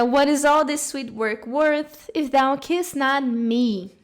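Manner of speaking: slow, tender reading of the line; poem reading. Shown as a demonstration. Teacher reading a love poem aloud to her class, slow and tender. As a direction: savour each word like a love poem.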